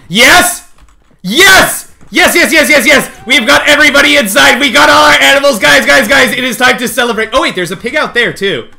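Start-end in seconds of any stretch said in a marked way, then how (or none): 0.1-7.3 higher, louder victory cheer; triumphant cheering. Real, not acted. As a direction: celebrate out loud. All animals finally inside.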